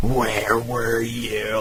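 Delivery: drawn-out, jealous "waiting up" voice; slow mock interrogation; jealous boyfriend. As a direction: imitate a possessive boyfriend, slowly. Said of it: "Like a jealous boyfriend or something".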